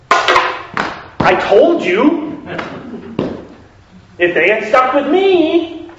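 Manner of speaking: high, loud and slow, a hammed-up angry protest; angry Samuel. Samuel angrily scolding God for changing his mind. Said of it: "He might be angry because it's like".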